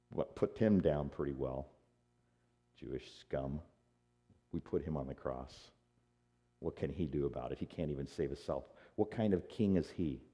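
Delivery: low, soft, scornful aside; mocking contempt. Soldiers deride the crucified king, quietly contemptuous. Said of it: "but the soldiers mocked".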